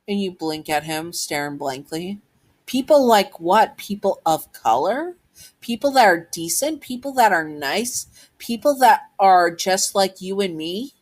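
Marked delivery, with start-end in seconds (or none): 2.6-10.9 higher, pointed rising questions; acted indignant challenge; challenging questions. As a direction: fire each question back with a lift at the end. Child challenges bully with questions.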